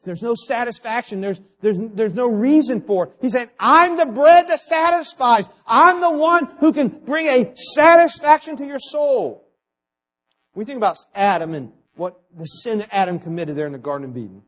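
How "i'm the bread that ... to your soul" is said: loud, high, drawn-out proclamation; 强力宣讲. Acted. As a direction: proclaim it slowly and loudly, pitched high. Preacher proclaims Christ's words.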